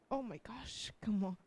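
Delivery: soft whisper under her breath; steady yourself in a hushed whisper; whispered to herself. Real, not acted. Speaker to herself at the pulpit, whispering to regain composure.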